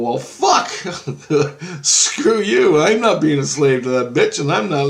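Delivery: loud, high-pitched angry refusal; defiant outburst. Retold or imitated. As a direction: push back hard, refusing to obey.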